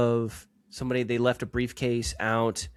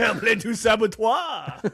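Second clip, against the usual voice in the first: mock French; 10 semitones higher, 4 dB louder than usual.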